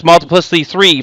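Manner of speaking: ordinary talk, nothing marked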